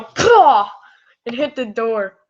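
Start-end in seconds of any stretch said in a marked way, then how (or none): 0.0-0.7 loud, high burst "pah!"; sudden explosive "pah"; imitated impact noise. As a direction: mimic a sudden smack into something. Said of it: "all of a sudden they go".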